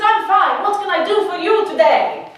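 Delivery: put-on high, loud Italian-accented shopkeeper; chirpy Italian-accented welcome; young man sounding Italian, not Palestinian. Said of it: "That's Italian".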